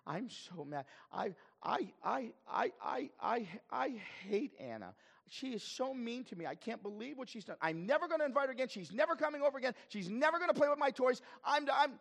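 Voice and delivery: hammed-up tantrum of a crying child, high and loud; Sylvia. Child runs to parent about a playmate, hammed-up tearful outrage. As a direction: wail it like an outraged child, pitch soaring. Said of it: "she's crying".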